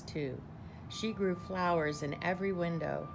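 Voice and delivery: ordinary delivery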